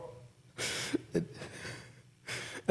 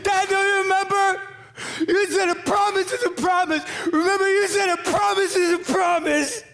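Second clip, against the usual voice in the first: high, loud, pleading shout; yelling through tears; 12 semitones higher, 4 dB louder than usual. Retold or imitated. Son yells to absent dad about his broken promise, crying. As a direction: beg him to remember, yelling and crying. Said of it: "started yelling".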